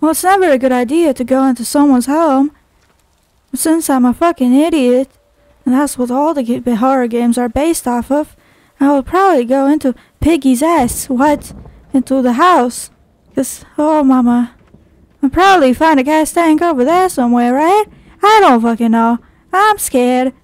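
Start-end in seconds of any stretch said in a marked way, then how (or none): none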